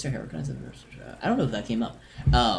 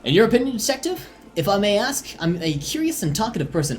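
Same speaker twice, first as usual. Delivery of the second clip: NPC voice; 6 semitones higher than usual. Acted. Voicing the curious NPC.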